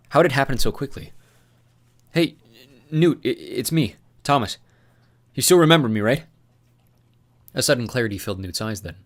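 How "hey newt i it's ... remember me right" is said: hesitant, coaxing voice, a little higher; gentle plea. Acted. Thomas coaxing his unstable friend, hesitant and gentle.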